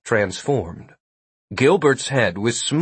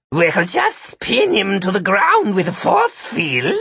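higher, slobbering monster voice; 8 semitones higher than usual. A narrator's reading. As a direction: speak through drool. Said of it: "Sid slobbered".

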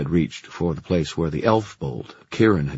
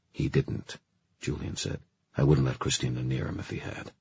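hushed reply; 4 semitones lower, 6 dB quieter than usual. Acted. Whispered reply.

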